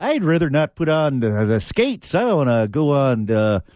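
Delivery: hesitant, polite mock-Canadian; hammed-up Canadian with little pauses; Canadian accent. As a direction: play a Canadian bashfully choosing wrestling. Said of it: "you got a Canadian accent".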